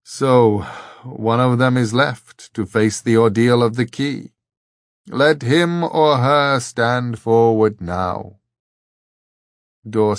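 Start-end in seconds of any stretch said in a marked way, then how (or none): 0.0-8.4 louder, solemn pronouncement with understated regret; regretful old abbot. A narrator's reading. Old abbot summons the last traveller to the ordeal, regretful. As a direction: pronounce it gravely, slowing towards the end. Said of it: "There was regret in the old man's voice".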